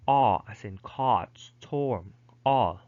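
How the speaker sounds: American "caught" and "all" with open vowel; American pronunciation. Shown as a demonstration. Teacher giving the American alternative, American vowel demonstrated. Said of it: "American English, these words are pronounced".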